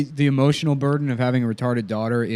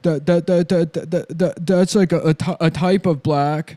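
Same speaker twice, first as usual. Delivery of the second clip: higher, stuttering movie character; Giovanni Ribisi; 4 semitones higher than usual. Acted. Boyfriend explaining being Italian, hammed-up stutter. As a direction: get stuck on words, then drag them out. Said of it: "Giovanni Ribisi, who's just, like, Italian".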